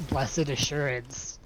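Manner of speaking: flat, slow spoken hymn line; deadpan lyrics. Pretend. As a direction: recite it utterly deadpan. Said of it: "said the lyrics in an utterly deadpan voice".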